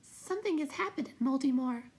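very high, tiny halting voice. A narrator's reading. Will-o'-the-Wisp reveals trouble at home, halting high voice. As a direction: pipe it out haltingly. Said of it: "said the Will-o'-the-Wisp haltingly".

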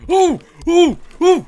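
sharp panicked shrieks, very high; jump-scare yelps; terrified scream. Real, not acted. Ghost appears, sudden fright.

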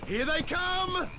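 calling out to the defenders as the attack starts; battle call. Acted. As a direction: warn the defenders the horde is coming. Battle cry as enemies attack.

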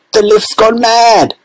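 high, slow alarmed cry; the old man aghast; alarmed shout. A narrator's reading. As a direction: Grandpa Joe shocked in the sky. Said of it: "shouted Grandpa Joe".

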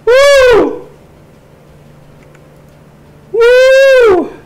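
high, loud whoop through the burn; high pained whoop. Real, not acted. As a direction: whoop out the spicy pain. Reacting to spicy burn.

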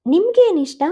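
eager question. Acted. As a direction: ask him back brightly. Girl asks him shyly back.

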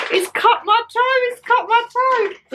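high, wailing mock complaint of a hurt toe; imitating mum's cry. Daughter mimics mum wailing about her cut toe, high and whiny. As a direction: squeal it like a wounded mum. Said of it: "she come in and said".

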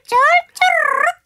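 high squeaky gurgle of an empty stomach; high voiced gut-rumble sound; belly rumble noise.

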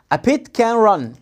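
words linked in one phrase; connected, measured reading drill; joined-up model reading. Shown as a demonstration. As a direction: run the words together without gaps. Then reads it joined up.

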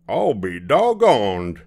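slow, loud astonishment; gasped amazement. A narrator's reading. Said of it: "gasped the driver".

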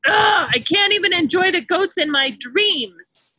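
fed up at being woken; grumpy woken wolf. Acted.

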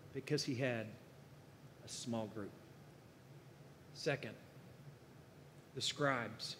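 hushed, intimate sermon point; quiet emphasis. Real, not acted. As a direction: let the point land softly. Preacher drops voice for emphasis.

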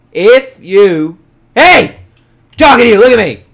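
high, loud demand for attention; yelling. Pretend. Gamer to animal not looking at him, yelled telling-off. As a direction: yell at a pet that ignores you. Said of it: "I'm gonna stop yelling".

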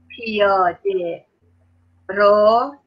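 پیاده then a pause, then رو, each stretched very slowly; slow dictation. Shown as a demonstration. Teacher dictating to the class, very slow and split apart. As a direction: spell it out word by word for the kids.

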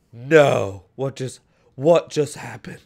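loud, panicked put-on accent of a friend; mock his buddy's accent as he freaks out; Kraft's accent freaking out. Imitating friend Kraft freaking out, in his accent. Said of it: "He's gonna freak out in his accent".

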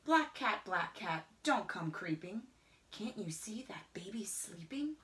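plain reading voice

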